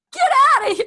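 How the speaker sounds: high, loud acted-out outburst; furious mock shriek; angry yell. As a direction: yell to throw someone out. Patient throws visitor out.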